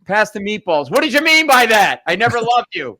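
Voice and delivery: loud, high dinner-table hollering; hammed-up shouted bickering; mock family yelling. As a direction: yell it like an Italian family at dinner. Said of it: "why was everybody yelling at each other".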